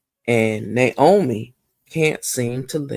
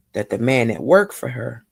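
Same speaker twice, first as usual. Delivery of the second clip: ordinary delivery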